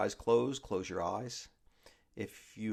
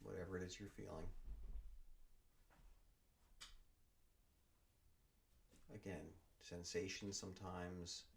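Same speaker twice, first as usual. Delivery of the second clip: guided meditation; 2 semitones lower, 7 dB quieter than usual. Acted. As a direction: ease them down into stillness, word by word. Leads a body scan meditation.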